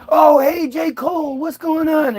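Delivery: beam at a famous face; eager greeting. Pretend. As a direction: starstruck high 'oh hey'.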